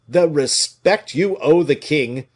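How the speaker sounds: loud, slow, furious retort; fiery cry. A narrator's reading. D'Artagnan hurls Colbert's words back, furious. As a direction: fling his words back at him. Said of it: "cried D'Artagnan, his eyes flashing fire".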